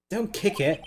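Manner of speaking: ordinary talk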